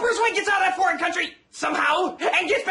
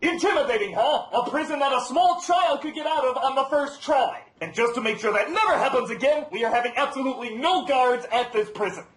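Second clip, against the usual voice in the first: masked villain Bane; 6 semitones lower than usual. Acted. Bane boasting about his prison, masked villain voice. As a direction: lower put-on villain voice, pompous.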